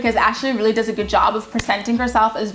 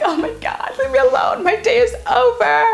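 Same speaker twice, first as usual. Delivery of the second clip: dramatic whine; 13 semitones higher than usual. Retold or imitated. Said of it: "I'm like".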